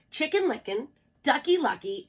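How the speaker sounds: plain reading voice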